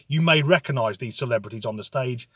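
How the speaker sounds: ordinary talk, nothing marked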